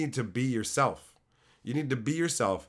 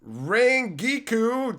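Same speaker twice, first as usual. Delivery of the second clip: drawn-out call; 7 semitones higher, 4 dB louder, much slower than usual. Real, not acted. Calling out a chatter's name.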